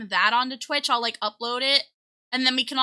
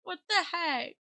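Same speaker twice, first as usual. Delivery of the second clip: soft, higher-pitched disbelief; small, stunned voice; quietly stunned; 5 semitones higher, 10 dB quieter than usual. Real, not acted. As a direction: say it under your voice, dazed. Still stunned by the gifts.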